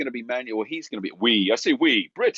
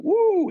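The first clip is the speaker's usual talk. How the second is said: high, loud "woo!"; awed whoop; 6 dB louder than usual. Retold or imitated. In awe of a big personality, a high whooped "woo". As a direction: whoop it high, as if bowled over.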